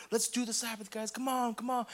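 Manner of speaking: rally the group, a touch excited; fired-up cheer. Pretend. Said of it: "people on fire for the Sabbath".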